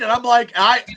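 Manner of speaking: normal talking voice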